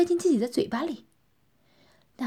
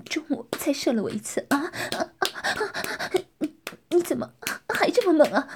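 breathless moaning speech, rising higher; 5 semitones higher than usual. Acted. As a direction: moan the words out. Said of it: "呻吟着说". (呻吟着说)

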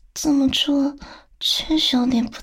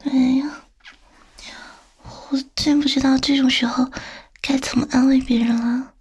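ordinary delivery

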